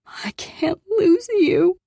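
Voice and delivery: high, slow, tearful plea; through tears. A narrator's reading. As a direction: choke it out while crying. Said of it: "I said through my tears".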